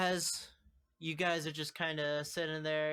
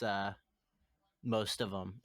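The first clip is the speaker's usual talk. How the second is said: usual speaking voice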